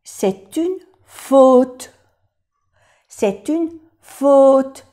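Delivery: "C'est une faute" spoken very slowly, the "au" in "faute" a closed O; "C'est une faute" slowly, each word apart for students; slow French demo. Shown as a demonstration. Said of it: "O sea que A U es".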